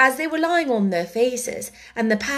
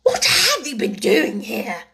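stern demand; 3 semitones higher, 4 dB louder than usual. A narrator's reading. Queen interrogates the gardeners.

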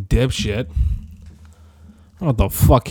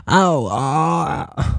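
lightly attempted impression, pitched higher; horrible Christopher Walken; 4 semitones higher than usual. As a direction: do a bad celebrity impression.

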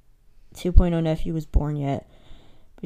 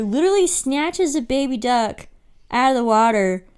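ordinary talk, nothing marked

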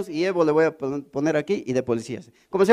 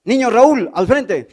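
loud, higher roll call; a mock stern teacher; 6 semitones higher, 8 dB louder, slower than usual. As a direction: summon the pupil to the front. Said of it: "Así llegaba mi profesor".

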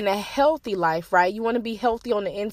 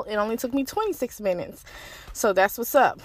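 normal talking voice

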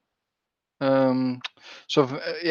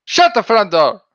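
loud, high shout; 6 semitones higher, 10 dB louder than usual. Shown as a demonstration. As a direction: belt it out at full volume. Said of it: "if somebody donates that you would do it".

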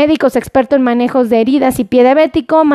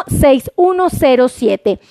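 ordinary talk

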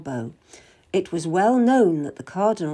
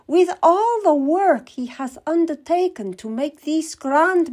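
much higher, slow and sugary; sweet scheming queen; 8 semitones higher, 4 dB louder than usual. Acted. Queen to Kath, baiting the Cardinal, much higher and sugary. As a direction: sweetly plant a worry in his ear.

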